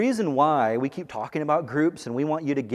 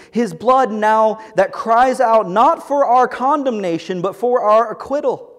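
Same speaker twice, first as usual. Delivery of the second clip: louder and higher, declaiming each line; forceful preaching; 7 semitones higher, 8 dB louder than usual. Acted. Pastor reading Tim Keller's lines aloud, forceful preaching. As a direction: proclaim it like a rallying cry.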